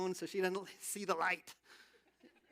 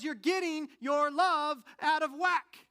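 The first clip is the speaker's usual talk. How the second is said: slow, emphatic and louder; a higher, weighty quoted rebuke; quoting Augustine; 7 semitones higher, 8 dB louder, slower than usual. Retold or imitated. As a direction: lean hard into each word of the warning. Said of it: "He says".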